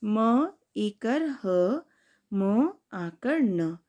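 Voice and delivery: slow, stretched letter names; slow spelling. Shown as a demonstration. Teacher spelling a new word for kids. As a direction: sound out each letter slowly. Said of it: "अब दूसरा शब्द है".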